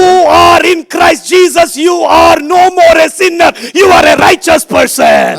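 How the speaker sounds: high, ringing pulpit declaration; emphatic preaching. Acted. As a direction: proclaim it to the congregation. Preaches at raised force.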